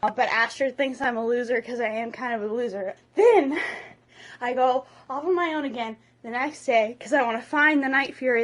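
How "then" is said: ordinary talk, nothing marked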